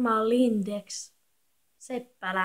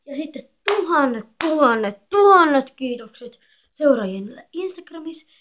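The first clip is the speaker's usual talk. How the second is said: emphatic thanks; 9 dB louder than usual. Real, not acted. Thanking Instagram followers.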